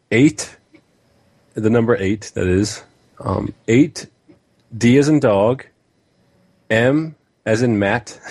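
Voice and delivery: slow code dictation, letter by letter; spelling it out. Shown as a demonstration. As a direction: read each character out with a rise. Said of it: "the premium demo code for the four characters".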